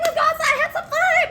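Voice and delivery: very high, loud excited call; party hype. Acted. Storyteller wanting a carefree fling, high loud hype. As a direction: hype someone up to go out. Acting out wanting a good time.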